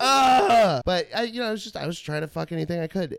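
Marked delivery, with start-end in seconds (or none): 0.0-0.8 high, loud disgusted groan; a big grossed-out ugh. Retold or imitated. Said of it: "And it was just like".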